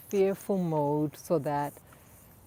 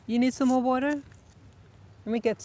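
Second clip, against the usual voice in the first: higher, louder coaxing question; pet talk; 6 semitones higher, 5 dB louder than usual. Real, not acted. Owner offering her dog more water, high coaxing pet talk. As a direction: coax the dog kindly in a raised voice.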